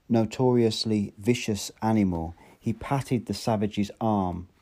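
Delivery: ordinary delivery, nothing marked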